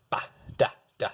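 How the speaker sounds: short, detached note syllables; staccato demo on nonsense syllables. Shown as a demonstration. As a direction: make each syllable a quick separate dot. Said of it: "just short".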